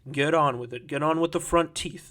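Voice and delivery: loud, impatient interruption; cutting someone short. Acted. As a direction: cut in forcefully.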